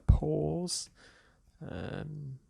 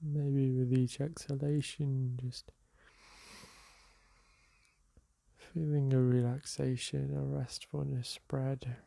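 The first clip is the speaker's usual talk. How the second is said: calm, softer meditation guide voice; guided meditation; 3 dB quieter, slower than usual. Acted. Teacher guiding listeners through a seated meditation, calm and soft. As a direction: ease the listener into the breath.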